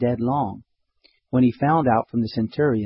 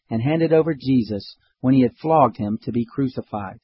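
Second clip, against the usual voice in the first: usual speaking voice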